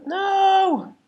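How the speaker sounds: high, loud wail of dismay; frustrated cry. Real, not acted. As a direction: cry out as the part slips. Part slips, frustrated cry.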